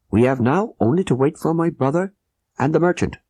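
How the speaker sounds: a hint of a tense, urgent plotter; Abdullah Khan. A narrator's reading. Abdullah voiced.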